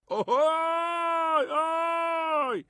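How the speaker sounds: very high, long drawn-out holler; echoing signal call. A narrator's reading. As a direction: call out high, holding the sound. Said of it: "صدای رمز پدر رو شنید".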